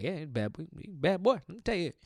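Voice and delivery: lightly voiced old uncle, rising on the second bad boy; a hint of an old man calling out. Future old man telling kids about the Seahawks, lightly voiced uncle. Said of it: "I'm gonna just talk like that".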